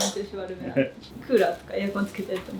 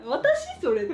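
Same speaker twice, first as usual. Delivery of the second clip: surprised; much slower than usual. Retold or imitated. Retells her own surprise.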